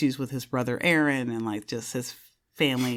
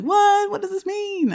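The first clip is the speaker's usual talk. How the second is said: baffled fans; 3 dB louder than usual. Retold or imitated. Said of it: "the fandom kind of being like".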